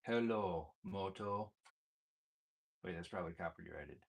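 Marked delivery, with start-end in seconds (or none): none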